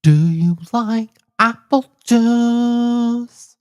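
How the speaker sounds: very high, loud and very slow; put-on character voice. Shown as a demonstration.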